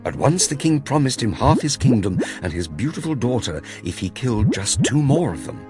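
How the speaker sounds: plain reading voice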